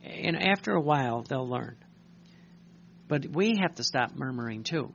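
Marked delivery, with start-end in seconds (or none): none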